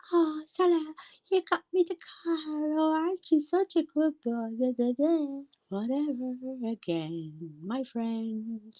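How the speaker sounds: high-pitched, drawn-out mocking baby talk. Mocking a giddy woman thanking him for the car, baby talk. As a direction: gush in a high, sing-song baby voice. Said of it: "her baby talk started".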